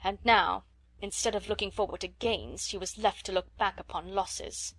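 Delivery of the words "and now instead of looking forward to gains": ordinary delivery, nothing marked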